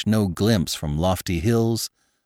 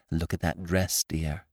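hushed, much softer aside; soft confidential murmur to her husband; whispered; 3 semitones lower, 7 dB quieter than usual. A narrator's reading. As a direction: lean in and murmur it softly. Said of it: "she whispered".

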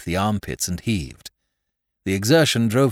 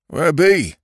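loud country yokel call; rustic farmer calling; 9 semitones higher, 7 dB louder than usual. Acted. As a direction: call out like a farmhand.

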